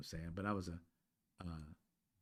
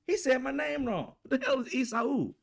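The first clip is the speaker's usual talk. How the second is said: put-on outraged kid; 6 dB louder than usual. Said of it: "I was like".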